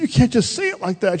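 high-pitched mock reproach; acted upset scolding. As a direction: imitate an offended sister telling someone off. Retold sister scolding the other.

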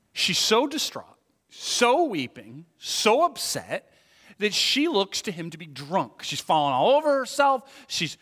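loud, high preacher's cry; impassioned preaching. Acted. Preacher describing Hannah's distress to listeners, impassioned. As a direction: pour out Hannah's grief with passion.

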